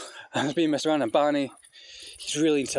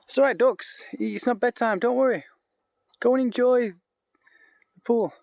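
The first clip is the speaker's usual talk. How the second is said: calm the ducks, drawn out at the end; sweet reassurance to the ducks; soothing pet talk; 8 semitones higher, slower than usual. Real, not acted.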